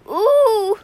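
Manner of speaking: loud high-pitched "ooh" wail; long, piercing storybook ooh; panicked cry. A narrator's reading. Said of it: "Lou cried".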